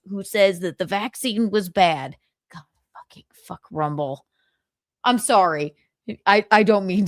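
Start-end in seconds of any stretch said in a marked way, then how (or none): 2.5-4.2 whispered, under the breath; exasperated whispered swearing; muttered curse. Real, not acted. Annoyed at the Rumble ad.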